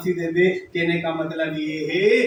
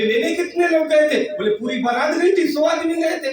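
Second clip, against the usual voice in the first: indignant father-in-law; 9 semitones higher than usual. Acted.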